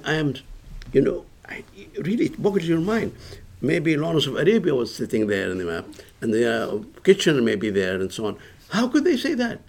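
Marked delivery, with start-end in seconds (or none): none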